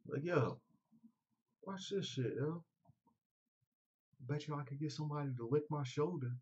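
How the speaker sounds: lightly voiced show-off; prankster bragging. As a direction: brag to the crowd like a show-off. Acting out a prankster.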